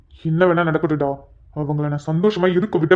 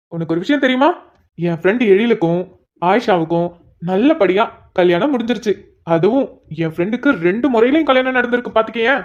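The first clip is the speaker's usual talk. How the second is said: usual speaking voice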